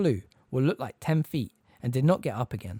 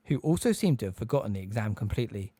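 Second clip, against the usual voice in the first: ordinary delivery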